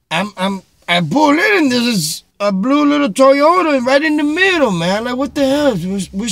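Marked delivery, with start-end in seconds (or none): none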